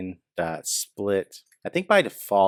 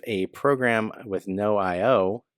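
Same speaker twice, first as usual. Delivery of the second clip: normal talking voice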